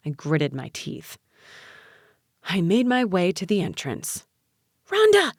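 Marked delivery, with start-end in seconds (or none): 4.9-5.3 high, urgent hiss of a name; tense, high-pitched hissed call. A narrator's reading. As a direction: hiss it sharply at someone walking off. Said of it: "Aunt Jerry hissed".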